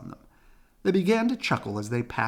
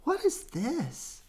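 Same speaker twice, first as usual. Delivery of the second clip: put-on child voice; 14 semitones higher, slower than usual. Sister asks, child voice.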